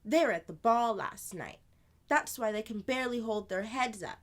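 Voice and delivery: put-on older sister voice. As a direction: play the lively older sister telling a tale.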